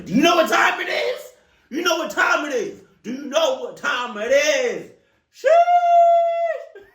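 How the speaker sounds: high, loud shouting through a laugh; furious shout. Pretend. Yelling at the stranger knocking on the window, loud and high. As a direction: blast it at the guy knocking.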